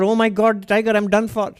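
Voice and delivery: a playful imitation of terror at the tiger; mock panic. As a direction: act out the fright he did not have. Imagined panicked reaction to tiger.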